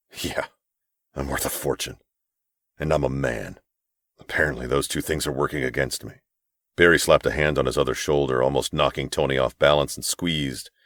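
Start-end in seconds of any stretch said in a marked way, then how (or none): 0.1-6.1 bitter. Acted. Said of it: "Tony gave a harsh laugh".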